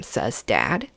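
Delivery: ordinary delivery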